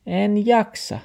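high-pitched excuse; talking dog. A narrator's reading. Dog makes excuses to grandfather, high-voiced.

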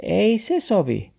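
high-pitched refusal; talking dog. A narrator's reading. Dog refuses grandfather's request, high-voiced. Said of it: "sanoo taksi".

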